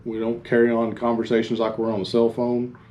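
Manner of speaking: usual speaking voice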